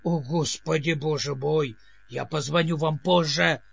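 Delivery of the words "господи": ordinary delivery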